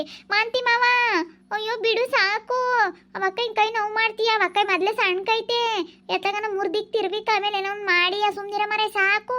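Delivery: high, pleading voice begging him to let go; pleading woman. Acted. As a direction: beg him to stop, pitched high.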